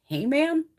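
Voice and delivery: disbelieving "Hey, man" rising at the end; incredulous echo. Retold or imitated. Retelling the courtroom outrage, incredulous. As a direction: repeat it as if you can't believe it. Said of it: "I'm like".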